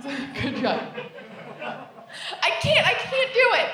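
ordinary talk, nothing marked

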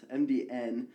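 ordinary talk

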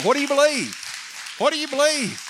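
high-pitched, urgent call to the crowd; preacher's challenge. Real, not acted. Preacher challenging the congregation to answer, high and fervent. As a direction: throw the question out, pitched high.

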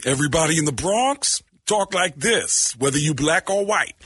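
lightly voiced Bronx comic; a hint of Tracy Morgan's Bronx voice. A Bronx kid imitating the neighborhood voice, lightly done. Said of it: "sounds like Tracy Morgan".

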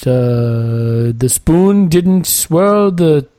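slow, drawn-out post-match manager voice; hesitant manager answer with long, stretched words; bad Arsene Wenger impression. Co-host plays the manager answering a post-match question, bad Wenger impression. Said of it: "those are the two worst Arsene Wenger impressions".

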